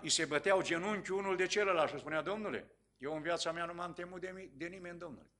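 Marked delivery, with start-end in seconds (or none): 2.2-5.3 softer, trembling confession; frightened sorcerer. Retold or imitated. The sorcerer before the pastor, knees knocking: scared and hushed. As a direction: admit fear in a shrinking voice. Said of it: "S-a speriat".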